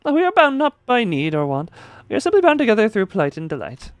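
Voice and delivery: high, loud and fast, rolling Rocinante as rrrosinante; grand knight voice. Acted. Streamer voicing Don Quixote declaring loyalty to her shoes.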